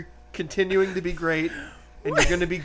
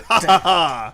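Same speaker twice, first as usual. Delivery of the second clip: teasing laugh; 6 dB louder, slower than usual. Real, not acted. Teasing, holding the ball.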